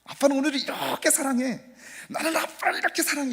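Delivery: put-on high childlike son's voice; little son voice. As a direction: play the little boy declaring love. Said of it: "이렇게 얘기하는 거예요".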